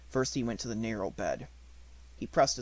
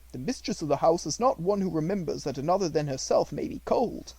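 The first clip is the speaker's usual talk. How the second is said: a lightly higher, respectful servant's voice; devoted servant Ramdas; 5 semitones higher, 6 dB louder than usual. A narrator's reading. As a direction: speak up gently for the child you watch over. Ramdas speaks.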